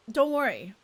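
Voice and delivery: ordinary talk, nothing marked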